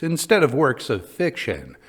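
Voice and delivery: plain reading voice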